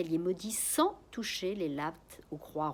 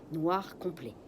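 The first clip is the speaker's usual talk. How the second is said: plain reading voice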